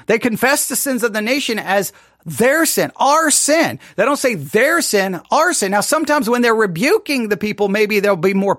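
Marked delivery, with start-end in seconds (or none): 3.0-3.8 our sin, with our lifted and leaned on; stress on our. Shown as a demonstration. Contrasting their sin with ours. 5.3-5.7 our sin, with our lifted and leaned on; stress on our. Shown as a demonstration. Contrasting their sin with ours.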